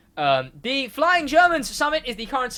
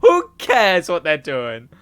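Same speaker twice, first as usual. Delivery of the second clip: mock giving up; 4 dB louder, slower than usual. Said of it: "we finally just go".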